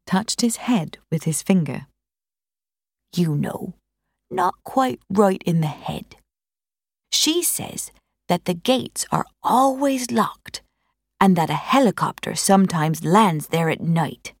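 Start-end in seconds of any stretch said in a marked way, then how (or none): 3.1-14.3 lightly voiced old country neighbour; a hint of a gossipy old moor man; old Dartmoor countryman. Old neighbour Bill passing on gossip, lightly voiced countryman.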